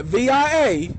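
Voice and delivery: "V I A" spelled as separate letters V, I, A. Shown as a demonstration. Driving and explaining the payment method, spelling "V I A" letter by letter. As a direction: spell "V I A" out clearly, one letter at a time.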